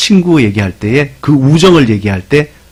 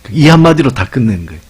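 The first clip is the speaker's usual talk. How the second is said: usual speaking voice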